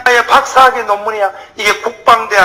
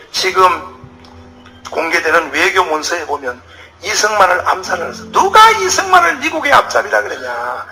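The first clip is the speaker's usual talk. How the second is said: usual speaking voice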